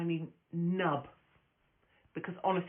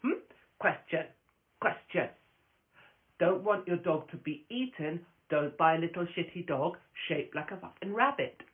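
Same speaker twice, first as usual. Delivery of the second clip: usual speaking voice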